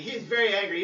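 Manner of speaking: ordinary talk, nothing marked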